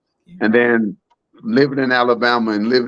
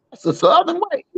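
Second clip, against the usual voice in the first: lightly voiced folksy president, pitched much higher; a hint of a proud Texan president; imitating the president. Chef voicing the president's parting joke, much higher.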